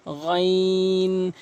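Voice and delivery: গাইন drawn out very slowly from the back throat; deep ghain. Shown as a demonstration. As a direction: drag গাইন from the throat's end. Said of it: "হলকের শেষ থেকে". (হলকের শেষ থেকে টেনে গাইন)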